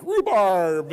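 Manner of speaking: loud mock outburst. Speaker mocking a cheap-laugh answer, loud drawn-out outburst. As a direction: blurt it big and long for a laugh.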